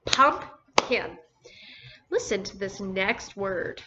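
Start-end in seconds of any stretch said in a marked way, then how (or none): 0.1-1.2 Pumpkin parted as pump, kin; teacher separating the two halves of Pumpkin; broken into syllables. Shown as a demonstration. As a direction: say Pumpkin in two even beats with a gap. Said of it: "two syllables".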